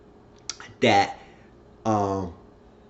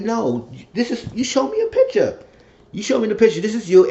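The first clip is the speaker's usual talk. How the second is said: high, raised-voice accusing; acted insistence; 11 semitones higher, 4 dB louder than usual. Man catching her out with the picture, accusing. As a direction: confront her with proof, pitched way up.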